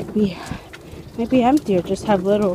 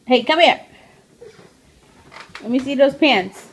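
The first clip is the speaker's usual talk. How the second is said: raised, higher-pitched call; calling over; 4 semitones higher, 5 dB louder than usual. Real, not acted. Mom calls toddler over to see pants, raised voice. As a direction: call the little one over, louder and higher.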